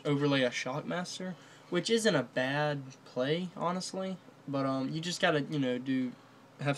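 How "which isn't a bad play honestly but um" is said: ordinary talk, nothing marked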